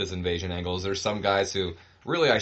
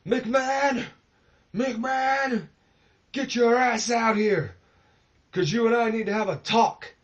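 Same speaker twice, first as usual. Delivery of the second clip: high, loud, screaming wrestler's promo voice; hammed-up Ric Flair yelling for McMahon; 14 semitones higher, 5 dB louder than usual. Furious wrestler screaming into the mic to call out the boss. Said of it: "he just starts screaming into it".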